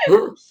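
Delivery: loud, high owl hoot. Acted. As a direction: call out like an owl. Player voices owl character.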